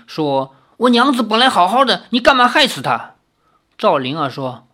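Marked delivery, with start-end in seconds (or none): 0.8-3.1 high, upset accusation; agitated villager in distress; angry and frantic. Acted. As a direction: accuse the person who harmed your wife. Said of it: "又气又急".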